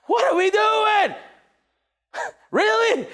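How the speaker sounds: high, drawn-out incredulous cry; exasperated shout. Acted. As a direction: wail it in mock disbelief. Mock exasperation at team.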